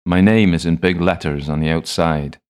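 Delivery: loud, pointed reply to a fool; exasperated chemist stating the obvious. A narrator's reading. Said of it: "looks at me like I'm a fool".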